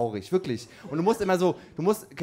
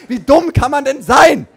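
loud, higher, slow outburst; exasperated shout; 10 semitones higher, 14 dB louder than usual. Real, not acted. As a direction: yell it in disbelief at the pigeon. Ranting about the stupid pigeon. (empörter, lauter Ausruf)

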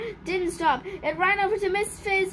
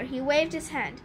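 ordinary delivery

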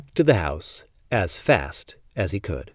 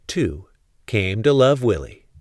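plain reading voice

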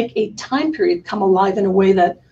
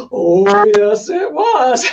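emphatic correction; 6 dB louder, much slower than usual. Retold or imitated. Kids correcting the son-in-law, slow and emphatic. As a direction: lean hard on every word, slowly. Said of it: "kind of rose up and kind of went".

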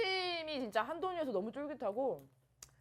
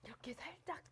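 whispering; 4 semitones higher, 13 dB quieter than usual. Real, not acted. Softly showing sauce dip.

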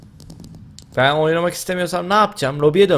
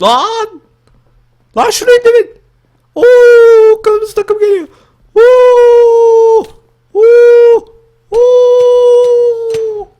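screaming; 12 dB louder, much slower than usual. Real, not acted. Screaming as the red team attacks.

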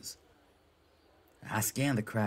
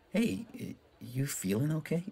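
concerned higher voice; 6 semitones higher than usual. Acted. Concerned character voice, high.

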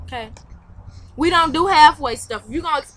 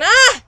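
short, high, loud yelp; sudden yell; 9 semitones higher, 9 dB louder than usual. Real, not acted. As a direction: yelp out abruptly. Sudden outburst while computer acts up.

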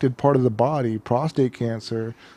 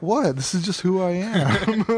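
higher, put-on indignant defence; mock earnest protest; 6 semitones higher than usual. Joking as the afflicted man defending his accent, mock earnest. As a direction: play it seriously, pitched up, for a joke. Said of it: "they take it seriously".

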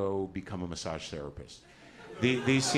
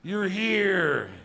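high, loud cry of amazed relief; hammed-up wail to a rescuer; overjoyed cry; 11 semitones higher, 7 dB louder, much slower than usual. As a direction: call out as if saved. Broken man greets Jesus arriving.

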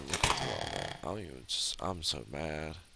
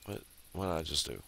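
normal talking voice

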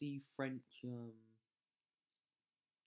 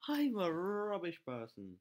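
mock self-scolding; 5 semitones higher, 7 dB louder, slower than usual. Real, not acted.

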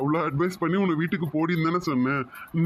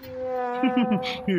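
high burst of laughter; laughing; 7 semitones higher than usual. Acted. Boyfriend laughing at his girlfriend's frantic hiding, high laugh. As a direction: laugh out at her panic.

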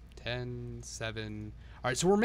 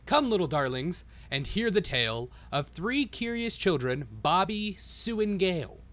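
1 storybook reading; 4 semitones higher, slower than usual. A narrator's reading. Reads the storybook rhymes.

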